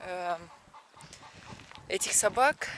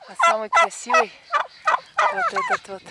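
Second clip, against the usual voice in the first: very high, louder excited cooing; delighted squeal; 8 dB louder than usual. Real, not acted. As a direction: squeal with delight at a pretty bird. Cooing at the bird.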